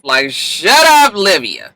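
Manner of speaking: fed-up, stretched-out telling off; exasperated. Retold or imitated. Exasperated retort to a character.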